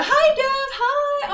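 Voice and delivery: high, loud, delighted hi; excited greeting. Real, not acted. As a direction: squeal a happy hello to a friend. Greeting a new chatter excitedly.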